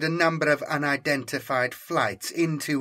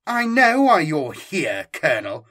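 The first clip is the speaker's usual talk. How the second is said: sharp, higher, louder snap at the colonel; snapping; 4 semitones higher, 6 dB louder than usual. Acted. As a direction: cut him off impatiently. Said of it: "Santos snapped".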